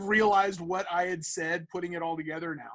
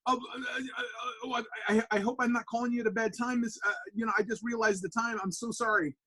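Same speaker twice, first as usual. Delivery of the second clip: high, stammering, apologetic; flustered apology; 5 semitones higher than usual. Retold or imitated. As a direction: replay a nervous fan tripping over an apology. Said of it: "I'm, like, freaking out".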